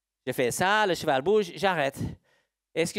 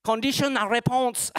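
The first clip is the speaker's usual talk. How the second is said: higher-pitched mock of own accent in French; mimicked accent; 6 semitones higher than usual. Teacher echoes someone copying their accent, high mimicked accent. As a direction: playfully parody the accent, pitched up. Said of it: "quelqu'un qui a répondu avec mon accent".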